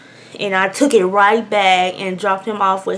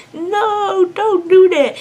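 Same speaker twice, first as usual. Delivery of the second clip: high, desperate plea; pleading; 9 semitones higher, 3 dB louder, much slower than usual. Retold or imitated. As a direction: beg him, panicked and high. Pleading to spare the cat.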